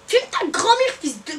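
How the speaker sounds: high-pitched furious jab; angry retort. Real, not acted. Lashing out at the one who filmed, a high, angry retort. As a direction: spit the insult out at the viewer.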